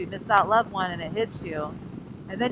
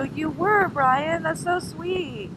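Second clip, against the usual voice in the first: high-pitched, touched cooing; gushing reply; 9 semitones higher, much slower than usual. Acted. Rider thanking Brian for worrying, high and gushing. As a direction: gush sweetly, drawing out the last words.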